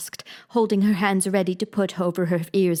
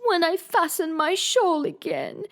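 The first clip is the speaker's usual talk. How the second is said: groaned out; 9 semitones higher, slower than usual. Acted. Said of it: "the poor Queen groaned out".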